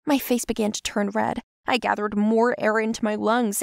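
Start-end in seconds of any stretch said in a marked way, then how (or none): none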